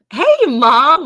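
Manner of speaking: loud call across the room. Real, not acted. Calling out to mom on stream.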